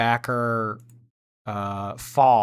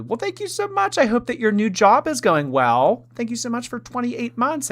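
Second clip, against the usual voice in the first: sweet high-pitched thank-you voice; squeaky grateful thanks; 12 semitones higher, 4 dB louder than usual. Acted. Read-out donation thanks, high voice.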